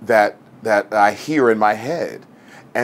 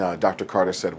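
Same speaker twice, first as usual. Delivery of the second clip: ordinary delivery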